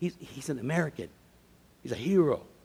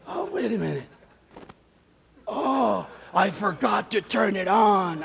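imitating an old man catching on, higher; drawn-out mock discovery, pitched up; sudden realization; 7 semitones higher, 4 dB louder than usual. Old man who forgot to turn it on, dawning realization.

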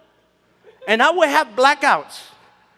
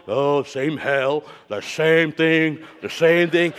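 weary coworker; 8 semitones lower, slower than usual. Retold or imitated. Coworkers' Monday blues.

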